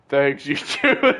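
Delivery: slow, mock manly reply; deep voice. [name] answering Serafina's compliment in a slow mock manly voice. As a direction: put on a mock man's voice. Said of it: "I'm doing, like, a deep voice".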